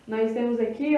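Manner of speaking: ordinary delivery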